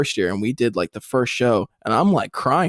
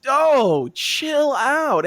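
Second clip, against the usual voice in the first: higher and louder, scoffing chill out; mock dismissive retort; 5 dB louder, much slower than usual. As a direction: brush off the hype guy mockingly. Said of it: "And it's like".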